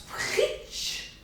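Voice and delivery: drawn-out, voiceless splash sound; splattering sound effect made by mouth; imitated splatter noise. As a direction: mimic grease spitting with a long hushed hiss. Imitates food splashing on the burners.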